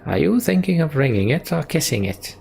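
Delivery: high, swooping character voice; teasing game character. Acted. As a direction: ask it high, sliding down and up. Voices Bar's question.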